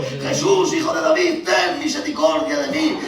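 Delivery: high, loud desperate cry to Jesus. A narrator's reading. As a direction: call out over the crowd, begging. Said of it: "empezó a dar gritos y gritó".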